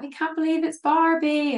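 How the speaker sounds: high-pitched imitation of thrilled stars; excited disbelief. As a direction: gush as if starstruck, retold. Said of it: "they were so excited to meet me".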